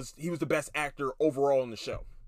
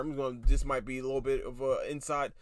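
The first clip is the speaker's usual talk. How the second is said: usual speaking voice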